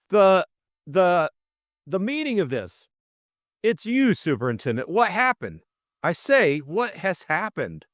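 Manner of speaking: high, halting exclamation; spluttering outrage. A narrator's reading. George bursting in on the superintendent, spluttering. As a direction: stumble on the first word, then demand answers. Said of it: "spluttering with obdurate words which refused to come".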